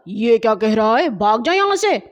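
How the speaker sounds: angry passer-by scolding. Acted. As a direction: tell him off loudly. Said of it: "ڈانٹ کر بولا".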